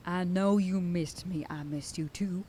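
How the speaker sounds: ordinary delivery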